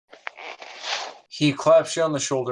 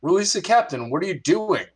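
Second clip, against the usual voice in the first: startled first mate, higher and louder; 4 semitones higher, 3 dB louder than usual. Acted. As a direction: blurt it out as an alarmed sailor. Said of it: "and he goes".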